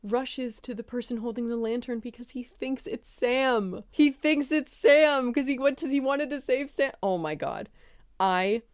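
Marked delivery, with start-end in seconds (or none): 2.3-6.9 high, pleading retelling; emotional, high-pitched wail of sympathy; distressed gushing. Real, not acted. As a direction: pitch up, dragging out the name.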